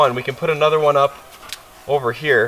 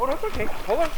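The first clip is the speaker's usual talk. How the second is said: high, reassuring coo; calm the frightened pup; high gentle reassurance; soothing the dog; 11 semitones higher, 4 dB quieter, faster than usual. Real, not acted.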